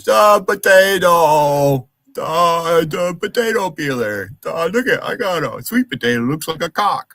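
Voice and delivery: hammed-up dimwit rambling about a potato; mock dumb voice. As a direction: sneer by sounding like a dimwit. Mocking a dim-witted chatter.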